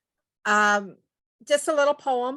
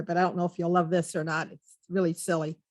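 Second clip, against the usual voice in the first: ordinary talk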